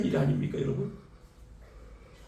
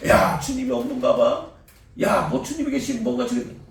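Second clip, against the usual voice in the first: mock grumbling; 7 semitones higher, 4 dB louder than usual. Grumbling believer voiced.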